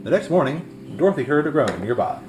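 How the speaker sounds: plain reading voice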